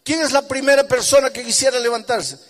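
high, loud preacher's call; preaching. Acted. Pastor calling the congregation to stand, full-force preaching.

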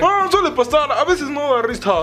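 squeaky-high mock of his excuse; exaggerated high-pitched mimicry; mocking imitation. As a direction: parrot him in a silly high voice.

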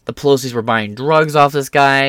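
normal talking voice

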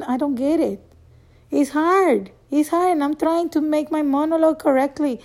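ordinary talk

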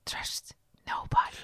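breathy, slow whispered sign-off. Shown as a demonstration. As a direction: whisper it slowly, voiceless. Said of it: "je moet fluisteren".

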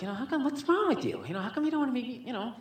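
put-on high, pleading girlfriend voice; girlfriend's voice. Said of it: "So she's like".

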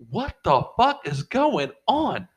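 high, drawn-out disbelief; baffled outcry. Retold or imitated. Telling friends about a noise on the call, baffled. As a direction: stretch out the retold bewilderment. Retelling own baffled reaction.

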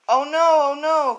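high, loud storybook cry of dismay; alarmed cry. A narrator's reading. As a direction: wail it out in dramatic alarm. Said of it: "cried the buffalo".

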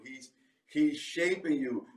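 ordinary delivery

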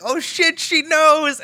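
high-pitched, thrilled outburst; excited realization. Retold or imitated. Co-host reliving the moment Indra knows, excited. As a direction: blurt it high, as if just catching on. Retelling own excited reaction to scene.

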